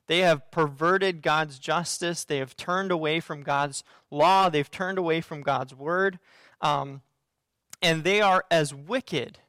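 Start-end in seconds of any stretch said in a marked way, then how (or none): none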